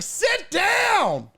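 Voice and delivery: high mock shout of a crowd; crowd yelling. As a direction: yell it at him from the stands. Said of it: "Everybody's like".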